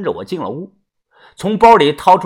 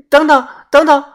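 high, loud call to stop him; a desperate woman calls him back; 10 semitones higher, 5 dB louder than usual. A narrator's reading. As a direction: shout after him as he leaves. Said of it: "大声地喊".

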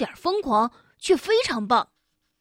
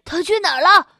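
angry; 6 semitones higher, 7 dB louder than usual. A narrator's reading. Furious child asks mother.

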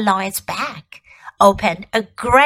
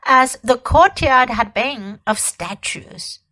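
ordinary delivery, nothing marked

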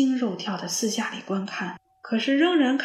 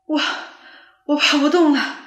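panting, loud and higher; out of breath; 5 semitones higher, 7 dB louder than usual. A narrator's reading. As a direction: gasp it out, exhausted. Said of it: "上气不接下气地说着". (上气不接下气地说)

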